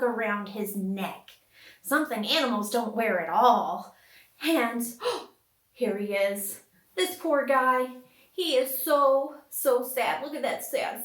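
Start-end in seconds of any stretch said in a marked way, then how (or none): none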